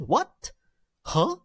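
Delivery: high, upswept "What? Huh?"; "What?" and "Huh?" shooting up as questions; rising tone. Shown as a demonstration. Teacher compares the tone, high rising "What? Huh?". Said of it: "A rising tone".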